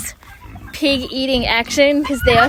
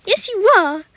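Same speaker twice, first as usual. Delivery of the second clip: high pet talk; 7 semitones higher, 4 dB louder than usual. Real, not acted. Praising the dog.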